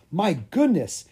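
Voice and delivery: taken aback, pitch lifted; exclaiming. A narrator's reading. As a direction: exclaim it as a startled king. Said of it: "exclaimed the king".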